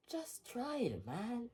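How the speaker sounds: small, hushed coaxing; subdued plea. Acted. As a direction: plead it very quietly. Subdued reply after being screamed at.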